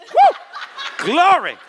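high, loud whoop of mock adoration; mock rapture. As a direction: whoop like an over-thrilled worshipper. Mock worship at seeing Jesus.

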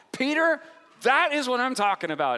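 high, loud "that is what I'm talking about"; Jesus cheering Peter on, high and loud; excited praise. Retold or imitated. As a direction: cheer him on like a proud coach. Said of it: "Jesus is just like".

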